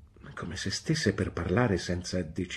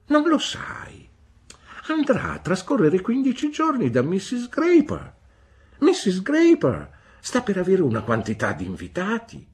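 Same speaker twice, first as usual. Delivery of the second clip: high nurse voice; 12 semitones higher, 8 dB louder than usual. Acted. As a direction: play the old nurse brightly reassuring. Peggotty answers, high voiced.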